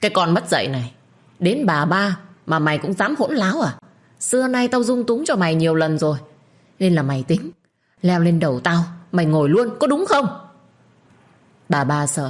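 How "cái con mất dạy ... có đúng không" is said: furious shouting. Acted. Father shouting down his rude daughter, building fury. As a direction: build from scolding to a full roar. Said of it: "Ông ấy quát om sòm lên".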